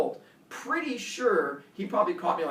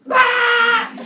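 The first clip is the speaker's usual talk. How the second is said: put-on high loud child scream; 14 dB louder than usual. Terrified kid screaming at the man. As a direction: scream in terror. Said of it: "I scream at everything he says".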